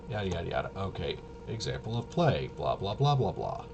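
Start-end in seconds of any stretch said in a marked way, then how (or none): none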